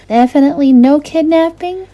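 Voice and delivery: high, youthful princess voice, a little wary; a sheltered girl recalling spooky Christmases; lightly voiced young princess; Rapunzel's voice. Rapunzel's voice in a read story.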